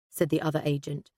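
plain reading voice

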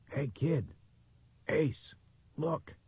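high, light hushed call; calling to the kid. A narrator's reading. Man pointing at the wall to a kid, high light call. Man calls boy to look.

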